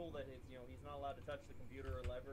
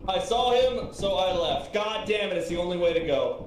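shouting; 2 semitones higher, 15 dB louder than usual. Real, not acted. Yelling over chat.

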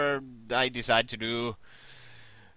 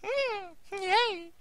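soft falsetto squeal; very high voice; 9 dB quieter than usual. Shown as a demonstration. Gamer amazed at own range, very high voice. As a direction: show off a tiny high squeal. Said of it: "my voice goes that high".

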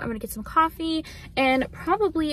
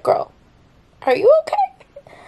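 much higher, louder, laughing-crying; voicing the dogs; 7 dB louder, slower than usual. Dogs asking their owner if she's okay, laughing through tears. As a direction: squeak it as the worried dog. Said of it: "they're like".

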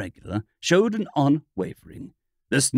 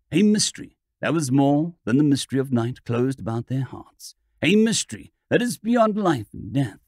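plain reading voice

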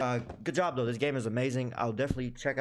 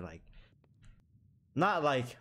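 ordinary talk

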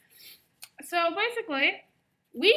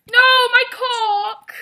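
high, loud, slow wail of dismay; mock tragic cry over the lost rooster; 11 semitones higher, 11 dB louder, much slower than usual. Real, not acted. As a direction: howl it as if the loss is tragic. Her rooster lost as she dies.

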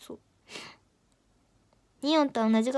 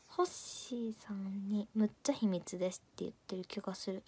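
whisper; 4 semitones lower, 8 dB quieter than usual. Real, not acted. Aside worrying about a reply.

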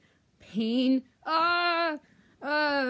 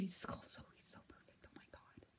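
breathless, hushed swoon; swooning whisper; 22 dB quieter than usual. Real, not acted. Fan swooning over Kylo Ren in a whisper. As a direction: whisper like you're in love.

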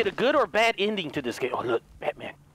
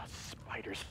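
whispering; 10 semitones lower, 20 dB quieter than usual. Real, not acted. Spotting spiders.

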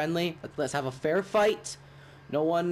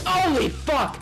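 shocked shout; 8 dB louder, slower than usual. Real, not acted. Sudden shock in game.

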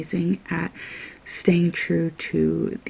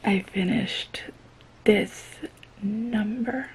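whispering; 8 dB quieter, slower than usual. Real, not acted. Late at night, others asleep.

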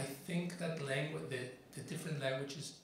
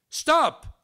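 sudden, very loud, high shout of Stop!; 14 semitones higher, 18 dB louder than usual. Acted. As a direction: yell it to freeze the man in place. Sniper shouts at the father.

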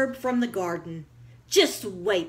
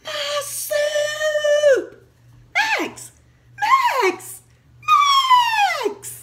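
very high, loud building shout of the name; furious shouting; 8 dB louder, much slower than usual. Acted. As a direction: scream his name louder each time. Owner discovers ruined soup.

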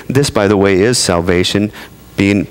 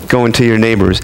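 normal talking voice

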